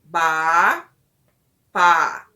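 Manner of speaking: "Ba" e "pá" isolados, altos e graves; sílabas de teste. Shown as a demonstration. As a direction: separe "Ba" de "pá" fechando a boca. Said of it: "O espaço entre uma sílaba e outra é o fechar da minha boca". ("Ba", pausa, "pá", em voz forte e grave)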